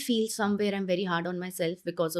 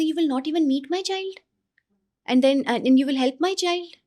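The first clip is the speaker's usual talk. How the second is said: higher-pitched disbelieving question, voice climbing at the end; incredulous parent; 7 semitones higher, 5 dB louder than usual. Acted. Parent to coach about not meeting the child, incredulous. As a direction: imitate a parent taken aback and questioning.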